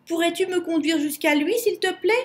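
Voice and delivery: high, quick polite request; eager plea. A narrator's reading. Lou begs the bear for help.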